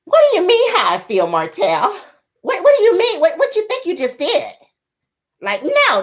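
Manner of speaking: high, put-on woman's voice, indignant; mock scolding woman. Mel scolding Martell about his performance. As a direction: ham up her disbelief.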